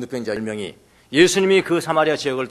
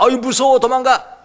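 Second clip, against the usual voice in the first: higher, louder scared cry; mock fright; 9 semitones higher, 6 dB louder than usual. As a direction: play the frightened runaway. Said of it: "우리가 생각하는". (높고 큰 겁먹은 흉내)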